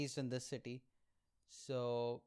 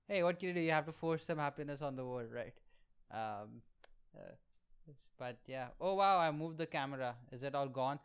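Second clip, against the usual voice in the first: ordinary delivery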